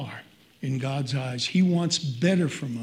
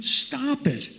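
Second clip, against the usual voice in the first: much higher, curt rebuke; God scolding; 9 semitones higher than usual. Retold or imitated. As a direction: cut him off like a fed-up father. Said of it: "God is saying".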